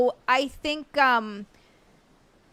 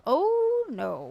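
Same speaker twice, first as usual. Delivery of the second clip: hammed-up sinking realisation; mock dismay; 6 semitones higher than usual. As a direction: swoop down into dawning regret. Acts out a regretful realisation.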